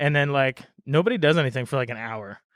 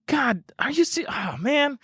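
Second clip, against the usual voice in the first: high surprised host voice; 11 semitones higher, slower than usual. Retold or imitated. House owner startled by the band at his door, high flustered voice. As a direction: voice jumps up high, flustered and caught off guard.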